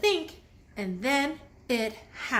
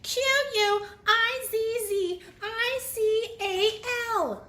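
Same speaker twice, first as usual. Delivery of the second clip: high, slow spelled-out cry; jolted awake spelling aloud; shouting the letters; 12 semitones higher, 3 dB louder than usual. A narrator's reading. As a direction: call out each letter loud. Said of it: "She woke up shouting".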